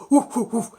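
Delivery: loud barking woofs; dog barking. Acted. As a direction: bark it out like the family dog. Family dog barks, imitated.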